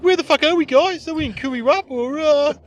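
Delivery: high, loud mock-bewildered comic; hack comic bit. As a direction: act it out as a hammed-up, high-pitched opener. Acting out hack opening joke.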